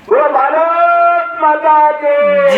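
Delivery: very high, slow, drawn-out slogan call; booming political rally speech. Real, not acted. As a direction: rouse the crowd with a long call. Leads slogan chant at rally.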